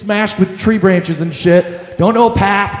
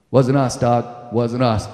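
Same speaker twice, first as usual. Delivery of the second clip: deadpan denial; 9 semitones lower, 5 dB quieter, slower than usual. Retold or imitated. Denying to the dad.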